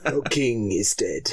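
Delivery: slow Spanish accent impression, with a laugh; a slow, dramatic Spanish-accented line with a laugh in it; mock Spanish accent, slowed and grave; Antonio Banderas accent. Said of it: "in my best Antonio Banderas, uh, accent".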